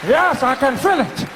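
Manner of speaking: normal talking voice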